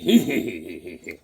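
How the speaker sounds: cartoon giggle. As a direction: snicker like a cartoon character. Voicing a drawn character's giggle.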